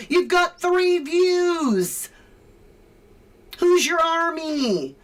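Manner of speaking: slow, loud, high scornful jeer; loud taunt. Real, not acted. Taunting a rival about his tiny audience, slow scornful jeer. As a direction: drag it out to rub it in.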